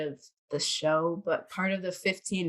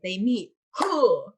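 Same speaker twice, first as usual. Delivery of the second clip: a surprised, lifted Who; stress on Who; 3 dB louder, slower than usual. Retold or imitated. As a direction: punch the question Who. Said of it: "I never got these lines right".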